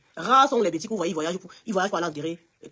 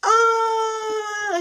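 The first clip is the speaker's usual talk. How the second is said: very high, sustained "aah"; high held cry of realisation; drawn-out cry; 13 semitones higher than usual. Real, not acted. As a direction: let out a long wailing exclamation. Reacting to revelation with long cry.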